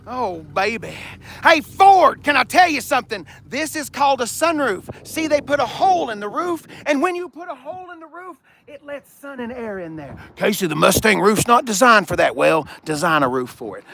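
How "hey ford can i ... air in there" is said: higher, teasing explanation to a car; mock explaining. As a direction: talk down to the car playfully.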